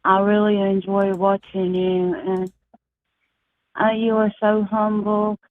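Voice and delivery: ordinary talk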